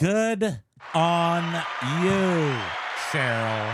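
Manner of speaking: loud, drawn-out cheer. Real, not acted. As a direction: call it out loud to cheer her on. Cheering on a seller.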